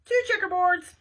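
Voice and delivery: loud, high diner-waitress holler. Acted. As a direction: holler the order through to the kitchen. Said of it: "hollered the waitress".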